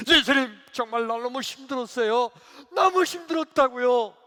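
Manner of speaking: loud, high acted plea; insistent complaint. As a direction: wail your grievance at Jesus. Acted deacon complaining to Jesus. (크고 높게 투정하듯)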